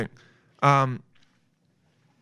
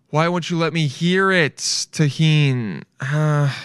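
ordinary talk

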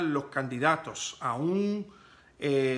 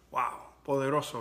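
ordinary talk

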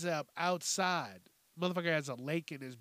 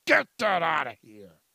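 much louder second take, pushed hard; loud dismissal; 9 dB louder than usual. Shown as a demonstration. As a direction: shove it away with a loud bark.

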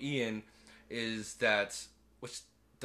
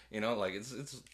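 ordinary talk

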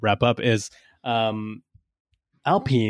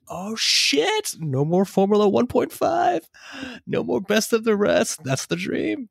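excited outburst; 11 semitones higher, slower than usual. Retold or imitated. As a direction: blurt it out like you just got great news. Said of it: "I was like".

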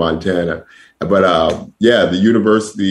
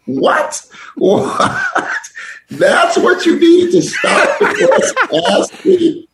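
high, laughing what-are-you-asking squeal; incredulous laughing disbelief; slower than usual. Retold or imitated. Telling the host how he reacted to the coach, high incredulous laughter. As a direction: replay the shock with cracking laughter. Retold shock at recruiter's question.

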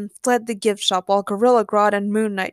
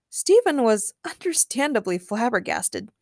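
usual speaking voice

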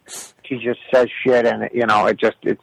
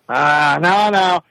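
ordinary talk

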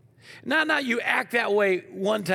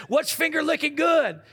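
teacher's quiz question; 7 semitones higher than usual. Retold or imitated. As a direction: call it out like a teacher quizzing kids. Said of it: "she said".

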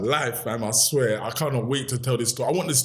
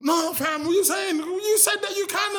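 much higher, pleading shout; heated argument. Retold or imitated. To the factory owner who refused, a high pleading shout. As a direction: shout him down, high and desperate.

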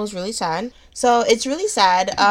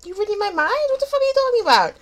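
very high mock outrage; high-pitched, hammed-up bafflement; incredulous reaction; faster than usual. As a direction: squeal it in disbelief. Acting out reaction to the stranger.

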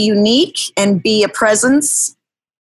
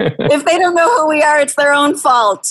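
mock indignant; 6 semitones higher than usual. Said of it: "No, I'm joking".

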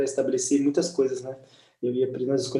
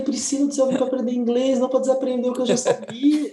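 much higher, playful exasperation; mock indignant complaint; 8 semitones higher than usual. Telling Priscila he is unlearning English, mock indignant. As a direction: protest in a raised pitch, for laughs.

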